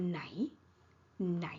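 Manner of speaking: just ไหน on its own, ที่ dropped; ไหน alone instead of ที่ไหน; shortened form. Shown as a demonstration. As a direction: say only ไหน, rising tone. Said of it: "often shortened to just".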